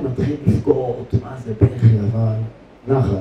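almost whisper the weeping; hushed telling. Real, not acted. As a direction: drop to a hushed, breathy voice. תיאור בכי גדול. (קול שקט ולחוש)

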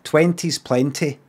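Twenties plenty in his own Scottish accent, slightly slow. Shown as a demonstration. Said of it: "Right in my accent".

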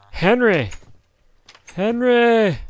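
high, loud call of a name. Real, not acted. Calling his golem Henry, high and loud. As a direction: call across the field.

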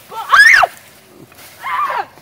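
hammed-up high-pitched yell; comic battle scream. Yelling during fake fight.

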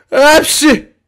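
high, loud sneeze. Acted. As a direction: burst out in a squeaky sneeze. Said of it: "hepsi hapşırıyor". (hapşırma)

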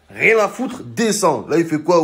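normal talking voice

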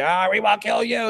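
lightly voiced menacing demon; a hint of a taunting spirit, held back; mildly sinister demon threat. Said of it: "how they speak".

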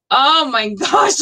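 ordinary delivery

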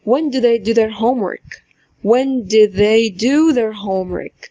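first "do" reduced to d, second "do" full and long; slow model: "when d' they do"; weak then strong do. Shown as a demonstration. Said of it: "اسمعوا". (الـ do الأولى مختصرة د والتانية طويلة)

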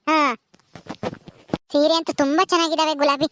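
ordinary talk, nothing marked

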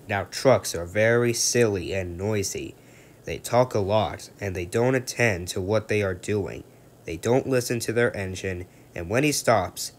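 usual speaking voice

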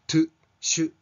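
"トゥ、シュ" with Japanese small u; katakana-style u. Shown as a demonstration. Teacher showing an easy reading, Japanese-style "トゥ、シュ". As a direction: say "トゥ、シュ" as katakana reads. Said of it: "小さなカタカナのう".

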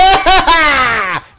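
loud, high whooping laugh; long gloating shriek of laughter; triumphant cackle. Real, not acted. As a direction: cackle wildly in victory. Crows after a kill.

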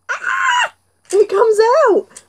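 high startled cry. Acted. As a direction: jump at the pop-up. Crocodile pops out.

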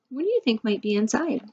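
usual speaking voice

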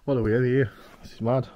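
loud, amazed outburst; amazed exclamation. Real, not acted. Explorer amazed by the kitchen clutter, loud and excited. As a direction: blurt it out, astonished.

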